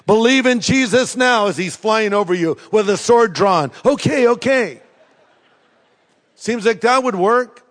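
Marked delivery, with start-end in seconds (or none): 0.1-1.5 archangel command. Pretend. 3.8-4.8 frightened okay. Pretend. Listener cowering before angel.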